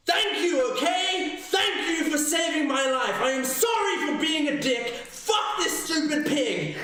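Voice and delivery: high, full-volume exasperated shout; angry, grudging thanks shouted at the top of the voice; furious shout. Acted. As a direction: yell it so the whole ship can hear. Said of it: "I just shout loud enough".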